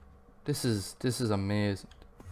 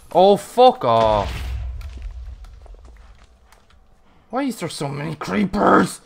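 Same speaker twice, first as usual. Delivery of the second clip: anguished yell; 6 semitones higher, 9 dB louder than usual. Real, not acted. Gamer yelling at creepers in despair, loud wail. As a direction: bellow it at the creepers.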